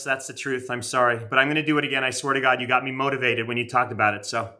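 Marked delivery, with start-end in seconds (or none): none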